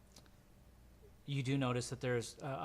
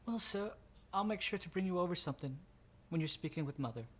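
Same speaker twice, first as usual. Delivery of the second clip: put-on higher, quicker polite servant boy; eager young helper offering food, pitched up; higher pitched Blake, fast and polite; 6 semitones higher, faster than usual. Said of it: "he looks at you and he just goes".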